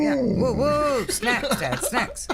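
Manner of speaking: high woo woo howling; playful pup howl begging; howling like a wolf pup. Pretend. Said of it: "woo howls with dog for snacks".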